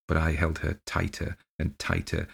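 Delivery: plain reading voice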